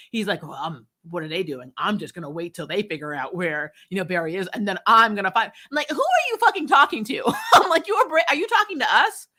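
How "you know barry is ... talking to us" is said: usual speaking voice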